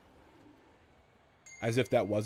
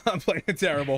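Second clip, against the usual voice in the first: laughing; 7 semitones higher, 6 dB louder than usual. Real, not acted.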